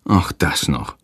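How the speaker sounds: low, weary groan. A narrator's reading. Father hears of the wet basket, groaning low. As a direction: sigh it out low and softly. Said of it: "stöhnt Hans".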